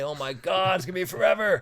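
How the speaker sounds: high-pitched mock despair; exaggerated dismay. Touring drummer stuck at home counts days, in mock despair. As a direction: moan as if the wait is endless. Acted impatience to leave home.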